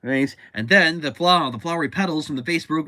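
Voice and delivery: ordinary talk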